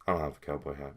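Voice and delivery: low, soft aside; muttered aside. Real, not acted. Vlogger to viewers before acting out Pomni, low aside. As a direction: drop it low as a throwaway.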